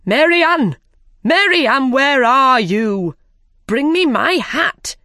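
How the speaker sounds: impatient rabbit calling for his maid. A narrator's reading.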